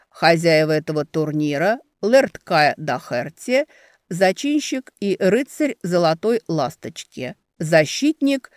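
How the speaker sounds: loud, slow formal announcement; herald's proclamation. Acted. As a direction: proclaim it over the crowd. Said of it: "Он говорил это чётко и громко".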